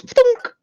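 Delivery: high, sharp mouth-made thunk; imitated thunk. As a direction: pop the clunk of a scope snapping on. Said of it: "and it goes".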